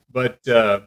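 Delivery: ordinary talk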